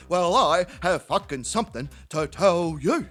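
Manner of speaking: low, emphatic drinking-mate voice; Terry. Acted. Drinking mate with news over the fence, low laboured voice. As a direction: announce it in a deep, laboured voice.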